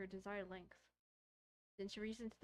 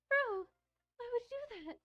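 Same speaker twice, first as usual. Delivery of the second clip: much higher and louder disbelieving cry; startled yell; 14 semitones higher, 7 dB louder than usual. Real, not acted. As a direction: yell at him for the stupid move. Reacting to player cutting finger.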